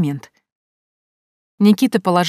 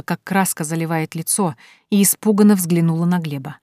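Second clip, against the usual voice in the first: ordinary delivery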